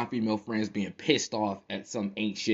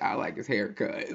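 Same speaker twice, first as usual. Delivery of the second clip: 1 laughing whisper. Retold or imitated. Quoting movie line, laughing.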